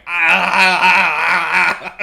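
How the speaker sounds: sudden anguished cry; overacted anime yell; dub scream. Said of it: "someone leans against the window, is just going".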